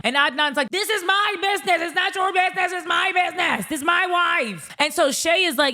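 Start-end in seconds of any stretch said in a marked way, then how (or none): 0.7-4.7 high-pitched mock protest; hammed-up indignant comeback; defensive retort. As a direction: imitate a husband insisting, pitched way up. Voices Adnan snapping back at brother.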